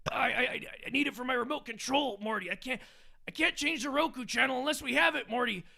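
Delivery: rambling self-important scientist, brushing it off; Rick impression. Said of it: "doing a Rick and Morty impression".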